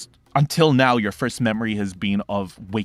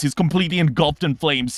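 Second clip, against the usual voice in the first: ordinary talk, nothing marked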